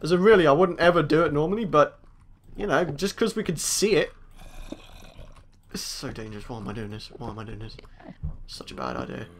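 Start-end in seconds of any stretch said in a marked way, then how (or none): 5.7-9.3 very soft, low mutter; nervous hush. Real, not acted. Player creeping into a dangerous cave, nervous hush. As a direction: murmur it to yourself, barely audible.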